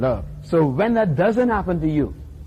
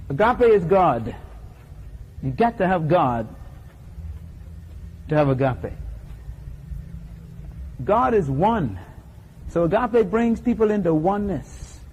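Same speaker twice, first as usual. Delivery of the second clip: solemn litany; 5 dB quieter than usual. Acted. Preacher's closing litany.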